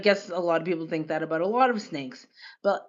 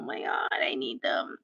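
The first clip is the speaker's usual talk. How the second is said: breathless whisper, lower; 8 semitones lower, 7 dB quieter than usual. Real, not acted. Streamer overwhelmed by cute quokkas, whispering. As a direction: whisper it like a confession.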